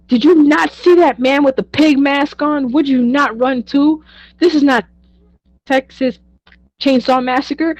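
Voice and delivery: loud, high-pitched incredulous self-defence; acting out an outraged, over-the-top argument; indignant protest. Defending herself to friends for fleeing the pig mask, indignant.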